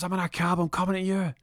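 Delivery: high, urgent phone plea; mock panic. Shot burglar warning his friend, high panicked voice.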